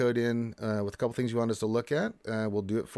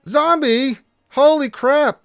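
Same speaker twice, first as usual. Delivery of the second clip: excited shout; 13 dB louder, slower than usual. Real, not acted. Excited greeting of a chat viewer.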